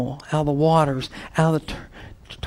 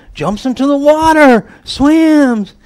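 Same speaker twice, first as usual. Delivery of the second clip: excited telling; 9 semitones higher, 9 dB louder, slower than usual. A narrator's reading. Excited retelling of Peter's jump.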